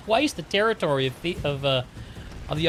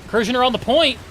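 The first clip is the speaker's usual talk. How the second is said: excited play-by-play; 9 semitones higher, faster than usual. Real, not acted. Live esports play-by-play.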